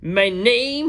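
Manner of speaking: loud, high heroic knight. A narrator's reading. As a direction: proclaim it grandly. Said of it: "he said".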